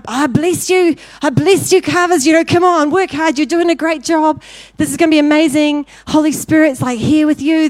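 high, loud, energetic mock blessing; rally the workers with rising zeal; hearty cheering-on. Man in dream blessing the carvers, hearty cheering-on.

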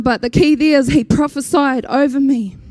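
ordinary delivery

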